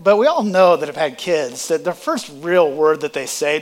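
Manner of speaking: ordinary talk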